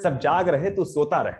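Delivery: सब जाग रहे तू सोता रहे as a recited verse; poem recitation. Acted. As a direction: declaim the refrain to an audience. Begins reciting his poem.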